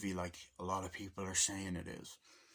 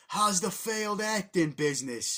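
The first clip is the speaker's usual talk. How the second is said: higher, louder and slowed-down dig; mocking taunt; 10 semitones higher, 10 dB louder than usual. Relative teasing Mario about his business, mocking. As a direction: needle him with a sneering question. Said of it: "it's like".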